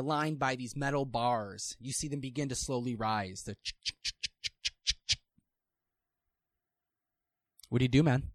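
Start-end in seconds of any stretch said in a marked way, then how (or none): 3.6-5.2 whispered ratcheting gate noise; unvoiced gate rattle. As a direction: rasp out a rising iron gate. Metal bars rising, gate noise.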